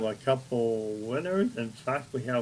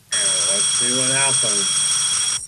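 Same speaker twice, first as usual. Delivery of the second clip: announcer; 6 dB louder, slower than usual. Acted. Race start announcement.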